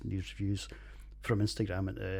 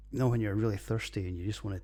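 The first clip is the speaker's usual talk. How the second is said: usual speaking voice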